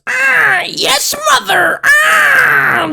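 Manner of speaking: high, shrieking, loyal squawk; eager crow servant. Acted. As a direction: screech like a bird eager to serve. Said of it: "The crow says".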